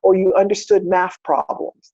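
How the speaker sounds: ordinary delivery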